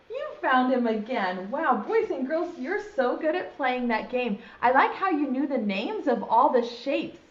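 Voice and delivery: ordinary delivery, nothing marked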